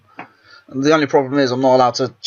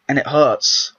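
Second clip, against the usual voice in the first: ordinary delivery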